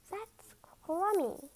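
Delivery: ordinary delivery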